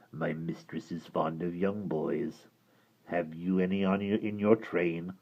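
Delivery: low gravelly creature voice; hideous creature speaking low; deep creature voice. Acted. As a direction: rumble it like a hideous lake creature. Creature asks.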